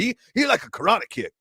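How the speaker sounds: mock thick Louisiana Cajun accent; swinging, sing-song Cajun rambling; Bobby Hebert. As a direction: ramble like a Cajun football commentator. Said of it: "hung out with Bobby Hebert".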